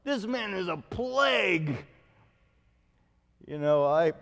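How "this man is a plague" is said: 1 mock indignation. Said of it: "what an insult".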